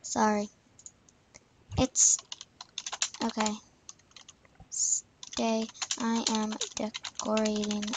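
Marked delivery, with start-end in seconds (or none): none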